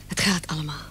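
hushed whisper of comfort. Acted. Wife reassuring her husband while they wait, whispered. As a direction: soothe him without being overheard.

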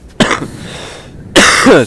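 loud, hacking cough; sick coughing. Real, not acted. Sick gamer apologising to viewers, real hacking cough. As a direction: let out a hard cough from illness. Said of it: "still a bit sick".

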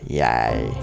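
normal talking voice

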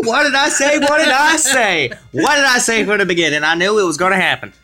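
high, crowing told-you-so; excited gloating. Real, not acted. Player proven right about the princess, excited crowing. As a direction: crow it in triumph, starting high then settling. Gloats over a correct prediction.

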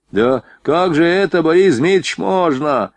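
affronted soldier objecting; indignant protest. A narrator's reading. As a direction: protest in disbelief, voice lifted. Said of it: "возмутился солдат".

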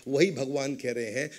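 ordinary talk

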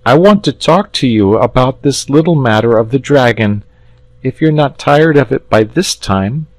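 a lad explaining earnestly; polite boy. Acted. Boy voiced in story.

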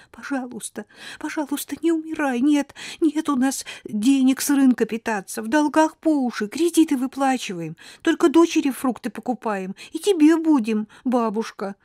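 high, tearful begging; wife pleads with dying grandmother, crying. Acted. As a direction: beg her not to die through tears. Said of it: "держала на коленях голову бабушки и плакала".

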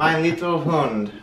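loud, high mock German; playful German-accented outburst; put-on German accent. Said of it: "speaking in the German accent".

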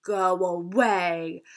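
slow, drawn-out boom. A narrator's reading. Townspeople chorus booming to scare the giant. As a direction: boom it out like a giant wooden man. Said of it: "booming voice shouting".

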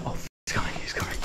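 sudden hushed warning; alarmed whisper. Real, not acted. Spotting the pursuer coming, alarmed whisper. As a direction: drop to an urgent whisper. Pursuer approaches the hiding filmer.

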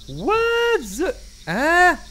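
loud high startled "hein". Real, not acted. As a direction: jump at the scare. Jump scare in horror game.

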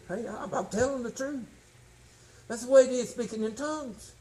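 usual speaking voice

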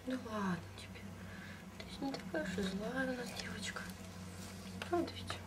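squeaky praising a dog. Real, not acted. Praising the calmed lizard.

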